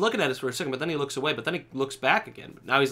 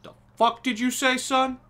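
high-pitched challenge, rising higher on the repeat; mock confrontation; 9 semitones higher, slower than usual. Player squaring up to a game character, high mock challenge. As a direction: play the affronted tough guy, pitched way up.

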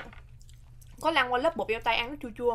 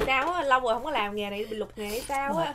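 ordinary talk

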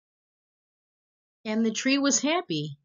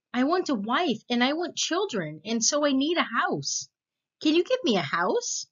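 put-on high, pleading young boy, quicker at the end; demanding boy; 7 semitones higher than usual. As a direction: beg like a boy who wants things. Boy asks for house.

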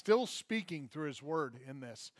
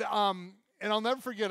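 ordinary talk

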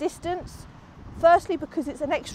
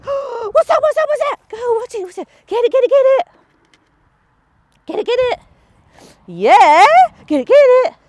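much higher, loud squealing "get it"; excited urging; 10 semitones higher, 11 dB louder than usual. Real, not acted. Trainer cheering Magpie on to grab the treat, high and loud. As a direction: whip up the dog's excitement.

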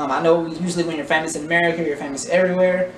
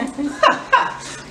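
breathy laugh, pitch up; real laugh; 8 semitones higher than usual. Real, not acted. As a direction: burst out laughing at your own joke. Laughing at his own joke.

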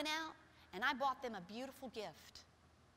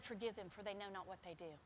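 soft, lower, tender words of Jesus; a soft, lower reverent quote; gentle quote; 5 semitones lower, 8 dB quieter than usual. Retold or imitated. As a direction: say it tenderly, quietly. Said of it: "And he also said".

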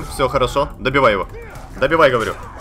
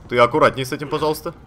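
ordinary talk

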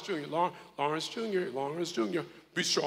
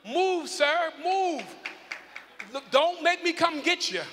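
playful scolding call; 7 semitones higher, 5 dB louder, slower than usual. Real, not acted. Pastor calling a youth forward.

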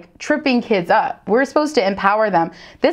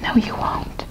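whispered aside; 5 dB quieter than usual. Real, not acted. Teacher contradicting herself to viewers, whispered. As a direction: sly whisper.

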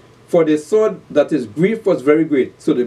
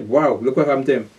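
ordinary talk

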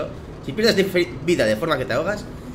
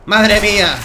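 loud, higher outburst of amazement; amazed exclamation; 4 semitones higher, 9 dB louder, much slower than usual. Real, not acted. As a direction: exclaim it at full voice. Amazed after a blast. (exclamación fuerte de asombro)

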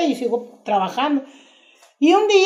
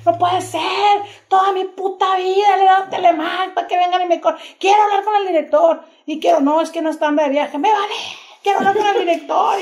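raised, higher-pitched indignant rant; retold angry outburst; 7 semitones higher than usual. Fired employee yelling at managers, mock indignant outburst. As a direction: demand the director, pitch climbing in protest. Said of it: "Y, y empecé a gritar".

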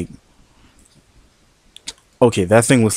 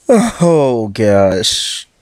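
shocked laughter. Real, not acted. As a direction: laugh in stunned disbelief. Laughing at game disaster.

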